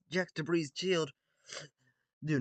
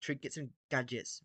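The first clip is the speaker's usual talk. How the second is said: plain reading voice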